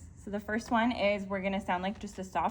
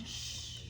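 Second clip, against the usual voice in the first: soft unvoiced shh like rain; long shush; 16 dB quieter than usual. Shown as a demonstration. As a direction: hush out a rainstorm. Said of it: "We're going to do an S H sound".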